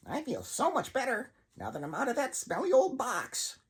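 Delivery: high, sing-song puppet; chirpy and a bit too sweet; cheery puppet voice. Acted. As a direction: puppet brushes off Ray's worry, chirpy. Puppet speaks, freed from box.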